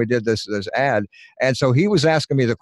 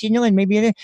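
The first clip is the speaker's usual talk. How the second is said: a bit higher cartoonish voice; changed voice demo; 8 semitones higher than usual. Shown as a demonstration. Voice actor showing a changed voice. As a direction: tweak the voice up for fun. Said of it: "I'd change my voice a little bit like that".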